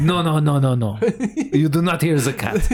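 mock French accent, insistent refusal; Christophe. Studio head refusing any cat voice, mock French accent. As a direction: forbid it in a put-on French accent. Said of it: "in his heavy French accent".

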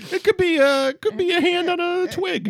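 high-pitched silly brainstorming; tentative, squeaky mock suggestion; goofy guess. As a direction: float daft guesses up high.

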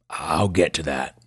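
put-on gruff old country patient; gruff old man. Old patient holds off the doctor's question, gruff. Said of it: "Pleasanton's voice was as gruff as his appearance".

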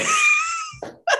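very high burst of laughter; real high-pitched giggling shriek; teasing laugh. Real, not acted. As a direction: crack up in a high squeal.

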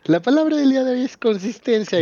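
high, announcer-style parody of the host; mock show announcer. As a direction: announce it like a cheesy TV presenter. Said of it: "Y ahorita sale Abelardo".

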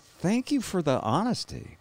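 a hint of weak, sincere gratitude from the bed; grateful patient. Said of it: "I was like".

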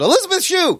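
high-pitched mock call-out; mock yell. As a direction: yell out a name as bait. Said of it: "I just yell out".

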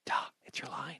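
soft unvoiced prompt; whisper. Real, not acted. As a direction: whisper the cue offstage. Prompting co-host during the reading.

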